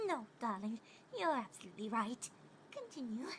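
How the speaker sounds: reassuring. A narrator's reading. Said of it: "She spoke to assure her".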